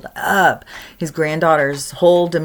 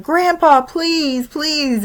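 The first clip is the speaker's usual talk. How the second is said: put-on much higher pleading child voice; begging little girl; 10 semitones higher, much slower than usual. Granddaughter begging grandpa in the car, high child's plea.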